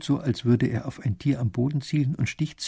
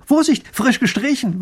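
higher, louder quick warning; warning call; 11 semitones higher, 5 dB louder than usual. A narrator's reading. Painter warns the lady off the wet railing. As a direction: stop her hand with a sharp warning. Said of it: "warnt er sie".

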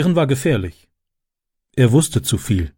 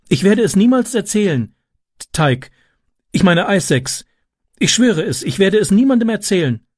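höhere, flehentliche Stimme; ängstlich beteuernd; 7 semitones higher than usual. A narrator's reading. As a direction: beteuere dein Schweigen, eingeschüchtert. Eingeschüchterter Junge schwört zu schweigen. (ängstliches Schwören)

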